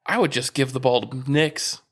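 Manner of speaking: ordinary delivery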